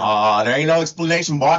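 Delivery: put-on country accent; storyteller with a mock country twang; Hee Haw Tony. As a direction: imitate your co-host's hillbilly accent. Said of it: "has to sound like me".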